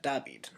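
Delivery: Dabid said softly as "dah-BEED"; Greek-style Dabid, "dah-BEED"; Greek pronunciation. Shown as a demonstration. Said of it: "something like that".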